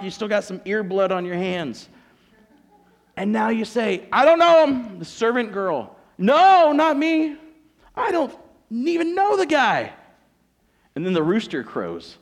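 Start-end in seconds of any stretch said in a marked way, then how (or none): 4.1-5.0 high, shouted protest; loudly disown him; acted cry of denial; emphatic denial. Denials replayed to servant girl. 6.2-9.9 very high, loud protest; acted, over-insistent disowning; frantic denial. Denials replayed as Peter.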